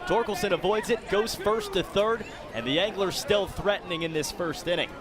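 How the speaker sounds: ordinary delivery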